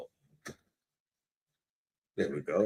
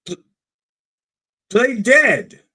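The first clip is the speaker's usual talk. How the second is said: high, loud frantic yell; yelled command; 14 semitones higher, 9 dB louder, slower than usual. Retold or imitated. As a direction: shout it as a panicked warning. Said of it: "somebody yells out".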